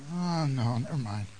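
low, soft, reluctant dismissal; acted backing off. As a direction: drop the voice, withdrawing what you started. Acting someone withholding a secret.

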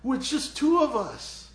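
high, loud, pleading doubt; anxious servant. Acted. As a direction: protest to Elijah that it can't be. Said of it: "his servant's going".

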